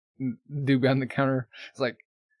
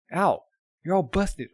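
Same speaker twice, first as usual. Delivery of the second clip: higher, louder, slow shocked outburst; shocked cry; 6 semitones higher, 4 dB louder than usual. Acted. Girl discovering Al's broken armor, loudly shocked. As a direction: gasp it out in dismay.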